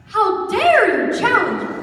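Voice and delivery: high, affronted announcer voice; indignant official authority. Acted. Announcer challenged by a young scout, indignant authority. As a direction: pull rank in a pompous official voice. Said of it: "I'm speaking in an official author-, authoritative voice".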